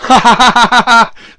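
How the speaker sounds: loud, high rapid cackle; mocking laugh. Real, not acted. Host amused at his own art-of-the-deal jibe, loud laughing. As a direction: burst into a long ha-ha laugh.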